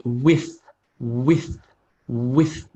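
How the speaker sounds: "with" said short and quick, tone rising; quick demonstration. Shown as a demonstration. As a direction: say "with" briskly, without stretching it. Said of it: "I'll just do it very qu-, quickly".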